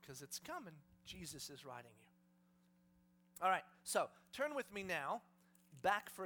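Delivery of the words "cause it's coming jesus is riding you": soft, low, cautious; hushed warning. Acted. Colt warning itself that Jesus is riding, hushed. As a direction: finish the warning under your breath.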